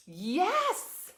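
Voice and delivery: loud high cheer; excited yes. Real, not acted. Reader cheering that the demolition is over. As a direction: celebrate it.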